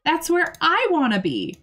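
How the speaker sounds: high, cheeky voice for a pet dog; voicing the dog Bessie. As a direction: speak for the dog in a high, bratty tone. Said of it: "and Bessie's like".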